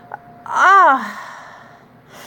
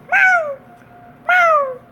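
put-on cat girl meow. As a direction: meow like a playful kitten. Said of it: "you want me meow again".

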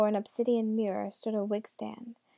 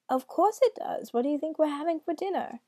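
high, loud put-on granny; grandmother voice; 6 semitones higher, 4 dB louder than usual. A narrator's reading. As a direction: retort in a high granny lilt. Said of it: "in his best grandmother voice".

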